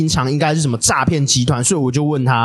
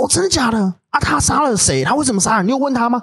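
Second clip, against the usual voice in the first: higher, eager rush of questions; excited coworker; 6 semitones higher than usual. Retold or imitated. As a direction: play the thrilled gossip hungry for details. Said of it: "他很兴奋说，". (模仿同事兴奋地追问，声音偏高)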